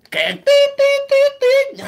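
high piping beep-beep of a car horn; mock traffic horns, tít tít tít; honking horn noise. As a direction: squeak out the horns of a jammed street.

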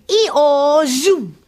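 loud, high braying zoom; donkey ee-oh zoom. A narrator's reading. Storyteller doing Dennis the speedy donkey. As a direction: bray it out and zoom off. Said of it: "He would go".

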